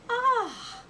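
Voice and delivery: high airy sigh of delight; dreamy breathed ah. A narrator's reading. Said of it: "breathed the blue owl dreamily".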